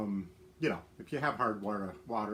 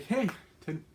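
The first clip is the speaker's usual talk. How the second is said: ordinary delivery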